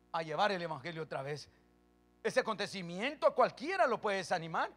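ordinary talk, nothing marked

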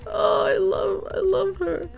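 high, crying-out adoration; squealing gush. Real, not acted. Streamer gushing to chat about Sam, high and squealing. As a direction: squeal your love for her.

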